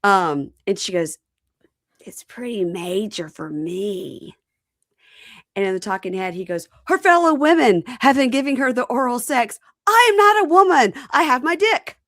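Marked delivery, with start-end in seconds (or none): 2.0-4.3 slow hushed whisper; mock secretive whisper. Wife's confession retold. 6.9-12.0 high, loud indignant voice; hammed-up outraged husband; mock indignant husband. Husband's indignant talking head.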